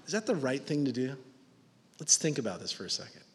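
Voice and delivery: soft, quiet fatherly talk; tender reasoning. Acted. A father, arm round his son, softly reasoning. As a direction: gently coax him to think.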